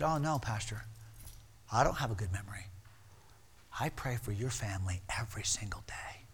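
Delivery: very soft and low, near whisper; hushed, sincere reply. Retold or imitated. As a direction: drop to a hush, humbly confiding. Retold humble, heartfelt reply after shouting.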